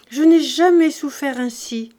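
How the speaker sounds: loud, high lament; anguished outcry. Acted. Daughter confiding her pain to her mother, anguished outcry. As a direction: pour out the suffering forcefully.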